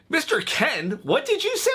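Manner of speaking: mock-horrified question, pitch leaping up at the end; scandalized retold "what?!", swooping upward; horrified disbelief. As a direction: imitate an appalled reaction, voice shooting high. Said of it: "Everybody was horrified".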